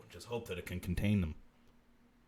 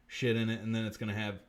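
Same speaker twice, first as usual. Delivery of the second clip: ordinary talk, nothing marked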